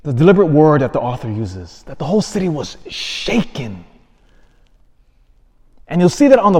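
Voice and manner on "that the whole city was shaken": "shaken" set apart and stretched; stress on SHAKEN. Real, not acted. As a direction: lean into the word "shaken". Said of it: "I'm emphasizing that word".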